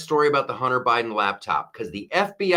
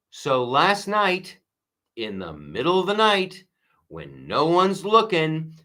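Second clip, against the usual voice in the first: ordinary talk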